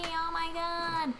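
high, drawn-out mock wail; mock distraught followers. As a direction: play an overdramatic online fan in distress. Voicing worried online followers.

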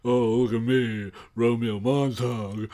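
mocking teacher impression. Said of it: "and he was like".

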